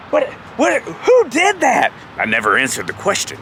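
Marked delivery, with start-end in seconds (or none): 0.1-1.9 high, drawn-out mock bewilderment; feigned innocence. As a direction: act shocked to dodge the blame.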